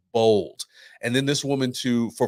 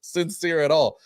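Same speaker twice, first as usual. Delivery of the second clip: laughing; 6 semitones higher, 5 dB louder than usual. Retold or imitated. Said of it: "you were like".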